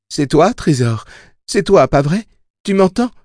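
high, eager questioning; appel plein d'espoir. A narrator's reading. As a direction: urgently check it is really them. Susanna calls back to Jake. (questions pressantes, voix haut perchée)

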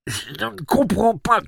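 high, slow nasal voice; pinched and scared; nasal frightened boy. A narrator's reading. Jake held by the nose, nasal and scared.

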